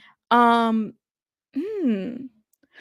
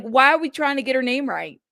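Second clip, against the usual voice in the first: usual speaking voice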